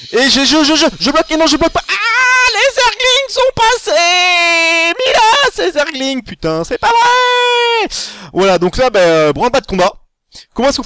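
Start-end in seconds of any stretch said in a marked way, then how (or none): 0.1-7.9 panicked yelp rising to a cry of disbelief; real dismayed outcry. Real, not acted. Streamer yelling as zerglings slip past his wall, real dismay. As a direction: voice shooting up into a high, loud wail of frustration.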